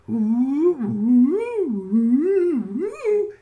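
very high, loud ghostly ooh calls; long eerie hooting cries; spooky Sasquatch hoot. Retold or imitated. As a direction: howl like a Sasquatch in the night woods. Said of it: "that kind of noise".

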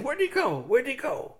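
very high, bouncing question; excited cubs. Acted. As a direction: ask like little cubs. Cubs jump around asking.

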